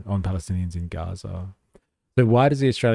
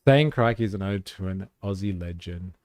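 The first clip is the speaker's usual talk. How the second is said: ordinary talk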